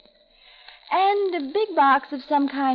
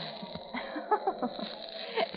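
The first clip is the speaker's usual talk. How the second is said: breathy mocking laugh; 4 semitones higher, 10 dB quieter than usual. Mocking the smeared lipstick.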